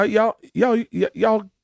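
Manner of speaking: mocking a Southern mom's accent, stammering over y'all; Leanne's Southern accent. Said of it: "Leanne's like".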